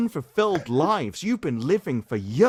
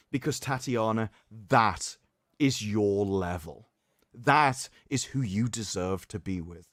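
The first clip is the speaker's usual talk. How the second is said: dramatic reading; 5 semitones lower than usual. Acted. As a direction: deliver it like a grave eulogy. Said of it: "That was a beautiful, dramatic reading".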